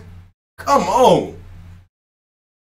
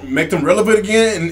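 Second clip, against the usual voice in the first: ordinary delivery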